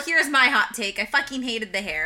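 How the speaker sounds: usual speaking voice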